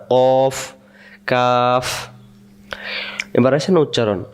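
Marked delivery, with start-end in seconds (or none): none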